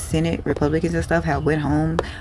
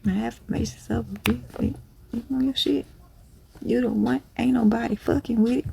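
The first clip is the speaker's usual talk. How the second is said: lightly raised, soft cheerleader voice; hype affirmations; 7 semitones higher, 5 dB quieter than usual. Acted. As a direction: talk yourself up, playfully. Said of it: "I have to hype myself up".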